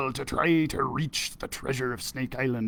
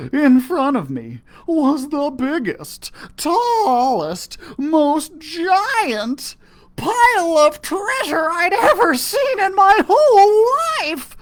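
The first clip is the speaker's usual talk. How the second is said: rising higher and louder; building excitement; 13 semitones higher, 7 dB louder, slower than usual. A narrator's reading. Narrator describing the treasure pile, building excitement. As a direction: let the wonder grow.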